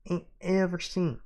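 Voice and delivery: grandiose Southern sheriff, swelling high and loud; Southern accent. Acted. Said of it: "I can do the accent".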